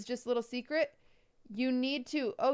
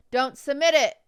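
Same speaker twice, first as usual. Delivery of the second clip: loud stern warning; 3 semitones higher, 9 dB louder than usual. Real, not acted.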